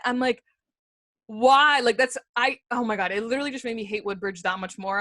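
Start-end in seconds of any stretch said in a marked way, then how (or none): 1.3-3.5 loud exasperated why; outraged why. Retold or imitated. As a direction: throw the question out in disbelief. Outraged retold quote.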